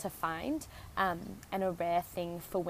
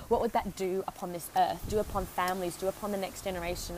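ordinary delivery

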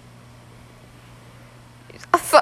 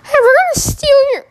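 high giggling taunt; 11 semitones higher than usual. Pretend.

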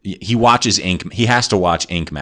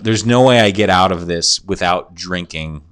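ordinary delivery

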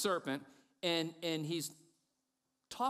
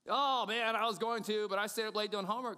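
mock excuse; 7 semitones higher, 4 dB louder than usual. Acted excuse about dishes.

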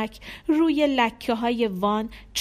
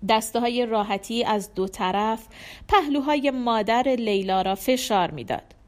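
ordinary delivery, nothing marked

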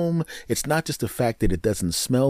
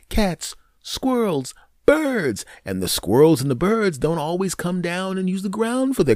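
rising list; 7 semitones higher than usual. Real, not acted. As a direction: build the list up high in disbelief. Listing animals, voice climbing high.

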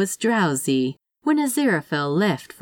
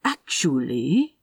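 firmly; 2 semitones lower than usual. A narrator's reading. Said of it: "Aziraphale said firmly".

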